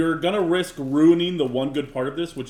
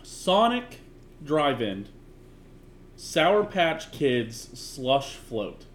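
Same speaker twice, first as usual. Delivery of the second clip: drawn-out announcement; 3 semitones higher, much slower than usual. Acted. Grandly announcing the drink.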